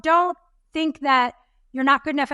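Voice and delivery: ordinary talk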